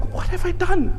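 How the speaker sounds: high, breaking cry of remorse; sobbing lament. Retold or imitated. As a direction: retell Umar's sob, voice cracking high and soft. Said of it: "He was just sobbing and".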